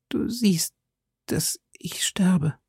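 high, whispery, fading words; failing voice, slow and halting; dying whisper. A narrator's reading. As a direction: gasp it slowly with the last strength. Dying woman's last words.

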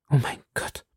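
breathy whispered sigh; a frightened woman sighing in a whisper. Acted. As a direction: sigh it out, scared, barely voiced. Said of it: "seufzte Madame Bonacieux".

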